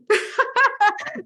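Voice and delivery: high, loud delighted laugh; real burst of laughter. Real, not acted. Laughs at her own idea.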